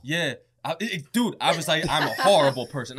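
loud laughing self-blame. Retold or imitated. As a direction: laugh while wailing you're awful. Retold guilty spiral, laughing.

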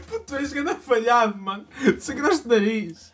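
high, loud mocking jeer; loud teasing. Real, not acted. Teasing friend loudly.

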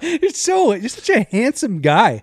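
high, slow fawning voice; hammed-up admiring tone; mock swooning compliment. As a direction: gush over him teasingly. Friend mocking the compliment.

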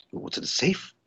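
whispered, creepy imitation of Todd; menacing whisper. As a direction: hush it like a threat.

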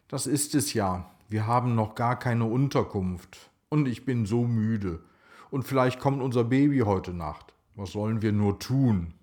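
usual speaking voice

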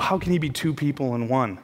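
ordinary delivery

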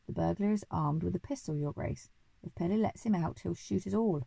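usual speaking voice

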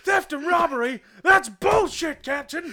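indignant shout. Acted. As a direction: shout back at the captain. Cop yells back.

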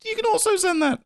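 high, bright announcer pitch; excited. Real, not acted. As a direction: sell it with a big upbeat lift. Enthusiastic outro plug.

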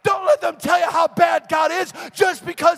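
high, loud, driving sermon shout; full-force preaching. Real, not acted. Preacher urging people to taste God for themselves, full-force preaching. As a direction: preach it at a shout, stretching key words.

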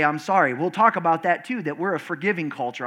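usual speaking voice

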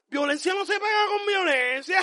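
high, loud, slow sermon-like cry; hammed-up preacher shouting about hate; mock preachy shout. Mock moral lesson about hate.

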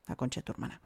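voiceless exasperated curse under the breath; muttered swearing. Acted. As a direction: swear softly to yourself, nearly whispered. Exasperated swearing aside after corrections. (puteada susurrada por lo bajo)

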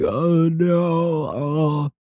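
high whimpering oh no; dismayed kid. Retold or imitated. Lost kid realizing the trouble, high dismay. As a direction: let the kid's dread rise high. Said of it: "I'm like".

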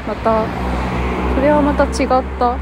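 speaking up over traffic noise. Real, not acted. Walking beside busy road traffic. (車の音に負けない大きな声)

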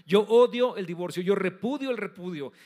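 loud, forceful pronouncement as God; emphatic declaration. Acted. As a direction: declare it with full force, every word driven home. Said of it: "Dios lo dice con todas sus letras". (declaración rotunda y enérgica)

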